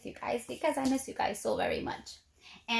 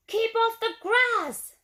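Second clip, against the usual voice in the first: high, louder, slow shout; 9 semitones higher, 5 dB louder than usual. A narrator's reading. Cactus shouting at the cowboy to keep off. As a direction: shout at the passerby. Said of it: "shouted Hank".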